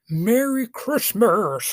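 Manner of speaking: high, slow, booming Santa greeting. Pretend. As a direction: call it out like Santa. Santa-style holiday sign-off.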